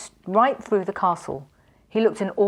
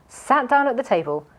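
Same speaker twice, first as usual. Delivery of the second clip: usual speaking voice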